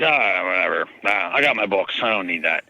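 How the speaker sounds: low, brushed-off "ah, whatever"; dismissive shrug. Retold or imitated. Imitating the uninterested customer, dismissive and low. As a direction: wave it off like you don't care. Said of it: "the guy just is like".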